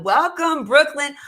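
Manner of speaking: high, bright-voiced shout-out to a viewer; excited welcome. Real, not acted. Greeting a viewer on livestream.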